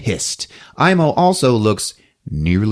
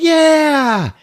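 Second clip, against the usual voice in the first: high, loud whoop; cheer; 4 dB louder than usual. Retold or imitated. Villagers cheering at the antlers, high whoop. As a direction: cheer like a crowd of kids. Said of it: "they're all like".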